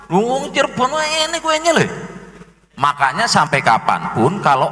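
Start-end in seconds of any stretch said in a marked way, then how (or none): 0.1-1.8 higher, amazed teasing; mock surprise. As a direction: act baffled at the wrong cake. Local mocks cake as Semarang's. (meniru orang heran yang mengejek)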